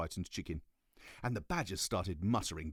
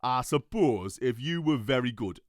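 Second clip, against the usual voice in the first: loud, bossy police cat; stern cat voice; 6 semitones higher, 10 dB louder than usual. Acted. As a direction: arrest them like a bossy cop.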